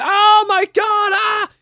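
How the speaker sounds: high yelp shouted way up in pitch; scream of pain. Retold or imitated. Patient at the free clinic yelping at the nurse's swab, high shout. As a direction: yelp as the scraper hits the sore.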